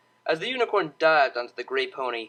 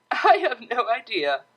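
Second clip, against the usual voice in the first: laughing; 8 semitones higher, 4 dB louder than usual. Acted. Octavia in a fit of giggles.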